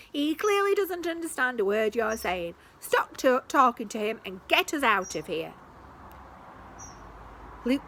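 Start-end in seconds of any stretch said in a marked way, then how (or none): 0.1-5.5 high, loud exasperated scolding; exasperated Malcolm. Acted. Malcolm fed up with Luke, high loud scolding. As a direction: snap at Luke to stop. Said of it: "said Malcolm".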